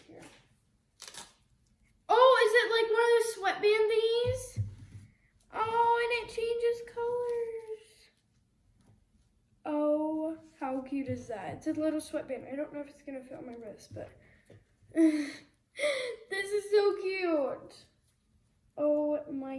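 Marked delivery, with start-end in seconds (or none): 2.1-7.9 excited. Real, not acted. Excited unboxing discovery. 14.9-17.7 laughing. Real, not acted. Gushing over toy, laugh heard.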